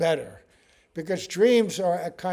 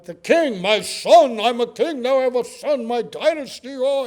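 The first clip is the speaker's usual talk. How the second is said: higher, louder royal outrage; indignant king; 7 semitones higher, 9 dB louder than usual. Retold or imitated. A king angrily defending his joy at having a son, high and loud. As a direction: proclaim it, offended and proud. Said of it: "He's angry".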